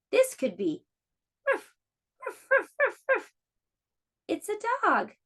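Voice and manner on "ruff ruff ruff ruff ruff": dog barking. Pretend. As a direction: bark like a small excited dog. Dog shadow guessing game.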